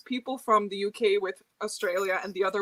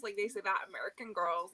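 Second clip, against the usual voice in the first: ordinary talk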